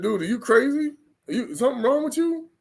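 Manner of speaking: high mocking voice of a scoffer. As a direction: ape the people calling you crazy. Mocking unbelievers as crazy.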